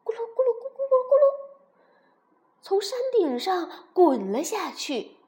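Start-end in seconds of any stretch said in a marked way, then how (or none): 0.0-1.2 fast, high, loud tumbling noise; rolling sound. Acted. Narrator imitates the dinosaur tumbling downhill, fast high rolling noise. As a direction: rattle it off like something rolling downhill.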